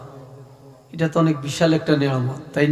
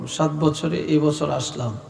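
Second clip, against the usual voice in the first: ordinary talk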